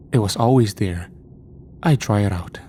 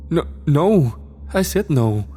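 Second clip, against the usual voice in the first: higher, tense insisting; frightened denial; 7 semitones higher, slower than usual. A narrator's reading. Scared girl denying to the angry father. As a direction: deny it in a frightened rush.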